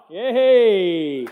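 loud, high happy yay; proud new grandpa cheering; joyful cheer. Real, not acted. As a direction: burst out in real delight. Announcing he became grandpa.

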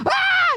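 short high-pitched yelp; mock scream. Preacher acting out a startle for the congregation, mock scream. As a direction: let out a sudden high scream. Cries out while telling a story.

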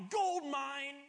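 bursting with greedy delight; mock-eager jackpot cry; excited. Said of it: "I'm like".

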